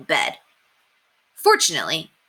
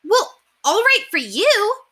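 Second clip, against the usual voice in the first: put-on high, slow, wounded little-girl voice; a stung girl answering back; hurt sister's comeback; hurt girl's retort; 5 dB louder, slower than usual. Beezus hurt by the boys.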